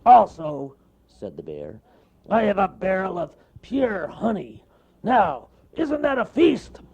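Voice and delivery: high, loud bear voice; proud show of the feast; cartoon bear, pleased. Bear shows off food.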